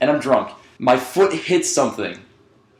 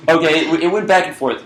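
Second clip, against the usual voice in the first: ordinary talk, nothing marked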